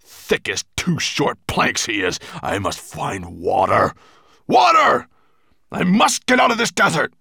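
loud dragon tirade, rising to a high shout; furious monster roaring; angry bellow. A narrator's reading. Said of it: "bellowed Nettlebrand".

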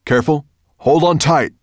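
loud, high warning call. A narrator's reading. Firedrake warns his passengers before take-off, calling out. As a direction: call back a warning to riders. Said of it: "called Firedrake".

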